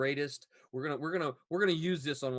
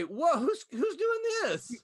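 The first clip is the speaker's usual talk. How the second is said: much higher and slow, exclaimed; playfully startled; mock astonishment at the screen; surprised; 6 dB louder, much slower than usual. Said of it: "I was gonna say".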